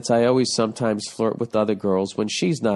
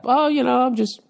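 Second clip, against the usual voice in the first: mock girlfriend; 10 semitones higher, 3 dB louder than usual. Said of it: "why did you just bring that up".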